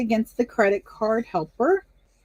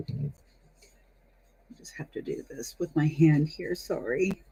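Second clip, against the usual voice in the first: holding back hiccups, quiet and tight; swallowing a hiccup mid-sentence; suppressing hiccups; 3 semitones lower, 6 dB quieter than usual. Real, not acted. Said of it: "Hmm, hiccups".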